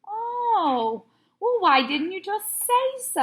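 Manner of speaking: put-on high, exasperated kid voice; why didn't you say so, high and fed up. A narrator's reading. Boy answering the officer, high exasperated whine.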